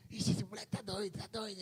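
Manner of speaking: voz do pai, levemente imitada; imitando o pai. Retold or imitated. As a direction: comenta de leve como o pai espantado. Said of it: "meu pai olhou assim". (filho imita o pai achando que ele está doido)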